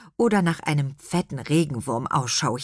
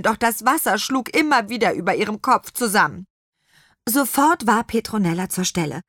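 plain reading voice

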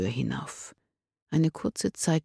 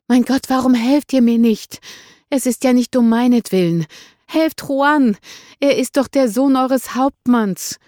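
high, loud, desperate plea; desperate cry for help; 10 semitones higher, 9 dB louder than usual. A narrator's reading. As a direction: call out, begging for help. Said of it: "versuchte Esmeralda zu rufen".

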